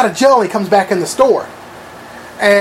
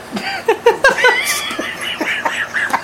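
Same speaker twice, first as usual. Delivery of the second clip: breaks into a squealing high laugh; a sudden high giggle fit; real laughter jumping way up in pitch; 4 dB quieter than usual. Real, not acted. Reacting to the hypocrite joke.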